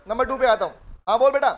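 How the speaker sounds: normal talking voice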